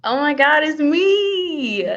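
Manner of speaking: high, loud gushing reveal; playful mock excitement. Presenter reaching her own intro slide, mock excitement. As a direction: squeal it as if thrilled to see yourself.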